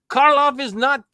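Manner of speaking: put-on high Slavic-accented outburst; hammed-up Eastern European accent; terrible Slavic accent. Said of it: "I'm doing a terrible Slavic accent".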